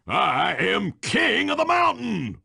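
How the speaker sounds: high, exultant victory cry; boastful champion's whoop; triumphant crowing. Acted. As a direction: crow it from the mountaintop. Crows after winning the climb.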